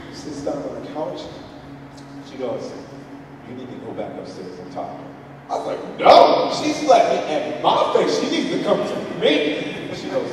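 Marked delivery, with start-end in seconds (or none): 3.5-5.1 low, soft, quick instruction; hushed, composed directive; calm wife. Retold or imitated. Wife calmly instructing husband. 6.0-9.7 higher-pitched protest, loud first word; retold petulant refusal; indignant dad.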